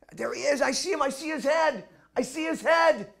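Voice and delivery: high, loud, ecstatic shouts; yelling at the top of his voice over the crowd; excited yelling. Retold or imitated. A soldier on a chair yells over the crowd, very high and loud. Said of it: "he starts yelling".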